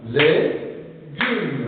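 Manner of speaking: "Légumes" drawn out with a gap, lé, gumes; "Légumes" slowly broken apart; split into syllables. Shown as a demonstration. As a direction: clap out "Légumes" beat by beat. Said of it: "Tapez les mains".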